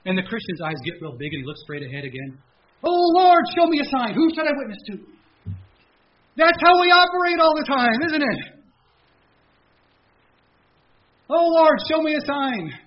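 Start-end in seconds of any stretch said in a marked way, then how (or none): 2.8-12.7 very high, loud panicked plea; frantic squealing prayer. Retold or imitated. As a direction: shriek it in comic alarm. Panicked prayer, comic climax.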